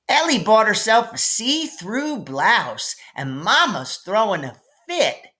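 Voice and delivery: put-on high, eager little girl spilling news; bursting to tell a juicy secret; little girl gossip. Little sister Maybell telling her brother gossip, high and eager.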